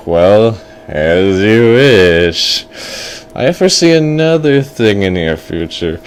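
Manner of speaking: slow, stretched-out threat; ominous fortune teller. Acted. Fortune teller threatens another prophecy, slow and ominous. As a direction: intone it like a villainous seer. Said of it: "He's like".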